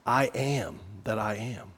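low, soft, hushed I am that I am; soft divine reassurance. Acted. Preacher voicing Jesus to frightened disciples, soft and low. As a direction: speak it gently as God's own name.

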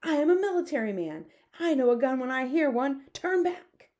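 high, loud, self-important outburst; high-pitched bellowed order; indignant shout. A narrator's reading. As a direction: shout it with full military indignation. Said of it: "shouted the head of the army".

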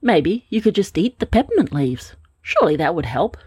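usual speaking voice

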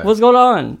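lightly acted call to people walking off; calling from behind. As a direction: imitate someone calling after you. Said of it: "I hear them in the background".

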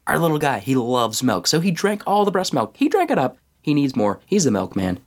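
high-pitched frantic babble; overtired parent rambling to a doctor; incoherent explaining. A narrator's reading. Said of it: "I incoherently explained".

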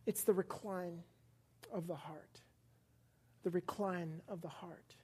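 hushed whisper of the key words. Real, not acted. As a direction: breathe it out softly, intimately. Preacher whispers key phrase.

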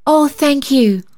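put-on high, grateful little girl's cry; grateful child. A narrator's reading. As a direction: thank them in a high child's voice. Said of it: "cried the child gratefully".

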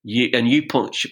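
ordinary talk, nothing marked

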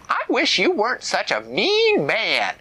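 put-on high, indignant child voice; little girl. A narrator's reading. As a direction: pout it like a small girl.

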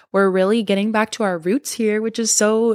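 ordinary talk

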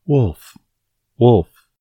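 calling out loud to the village; shouting. Acted. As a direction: yell it out, high and loud. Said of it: "shouting at the top of his voice".

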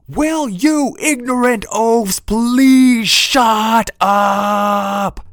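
slow, high, loud bellow. Acted. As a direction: roar it over the crowd. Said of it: "Sarah bellowed".

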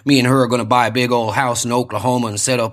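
low, wistful American soldier voice; war-movie soldier. Acted.

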